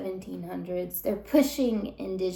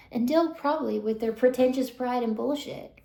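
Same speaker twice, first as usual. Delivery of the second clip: normal talking voice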